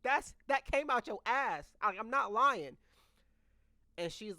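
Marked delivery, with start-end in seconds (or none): 0.0-2.8 higher and louder, pointing it out; pleading not to be doubted; insistent. Retold or imitated. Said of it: "I'm like".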